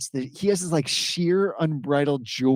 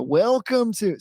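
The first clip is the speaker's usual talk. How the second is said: excited radio announcer; 7 semitones higher, 4 dB louder, slower than usual. Shown as a demonstration. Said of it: "what I just did there was".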